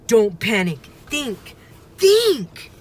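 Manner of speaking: from tense to loud high think; rising panic. A narrator's reading. Boy trying to calm himself near the monster, panic building to a shout. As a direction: lose the battle to stay calm.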